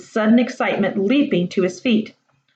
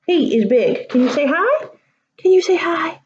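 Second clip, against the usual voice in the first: higher sing-song pet talk; 8 semitones higher than usual. Real, not acted. Reader showing her cat to the children, cooing. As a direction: coo at the cat.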